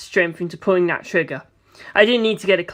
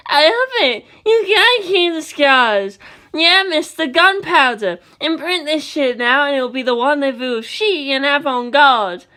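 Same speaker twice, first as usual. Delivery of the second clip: high, excited fashion chatter; tiny fashionista voices; 7 semitones higher than usual. Acted. Tiny bullet riders gushing over Doppio's scars. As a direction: gush like tiny stylists.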